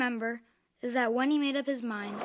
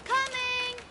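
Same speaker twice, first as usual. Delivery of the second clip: put-on high child's shout back; kid calling back. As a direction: answer from across the house. Kid answers mom's call.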